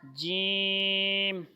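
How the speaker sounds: جیم stretched very long and held; drawn-out jeem. Shown as a demonstration. Quran teacher models جیم for children, long and held. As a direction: hold the sound of جیم out slowly. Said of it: "سخت آواز آئے گی۔".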